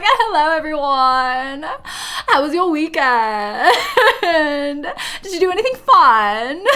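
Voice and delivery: put-on high, drawn-out British Valley Girl greeting; hammed-up posh Brit Valley Girl, stretching the endings. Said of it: "show up at work the next day and be like".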